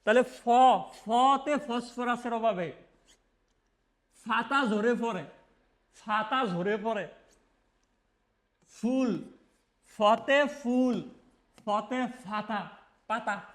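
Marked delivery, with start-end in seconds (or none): 1.0-7.1 drawn-out ফাতা with an aspirated ফ, the way the region says it. Shown as a demonstration. Teacher demonstrates the dialect ফ for পাতা, slowly. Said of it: "তুমি পাতারে উচ্চারণ করবা ফাতা". 9.9-12.7 ফতে ফুল, ফতে ফাতা dragged out with ফ for প; slow dialect ফ. Shown as a demonstration. Teacher drills the dialect ফ on ফাতা for students. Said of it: "তুমি পাতারে উচ্চারণ করবা ফাতা".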